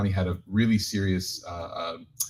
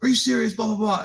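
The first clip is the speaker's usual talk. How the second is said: mock outraged passengers; 14 semitones higher than usual. Speaker mimics upset passengers on the delayed plane, high mock outrage. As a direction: play the fed-up passengers, squeaky and indignant. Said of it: "everyone starts losing it".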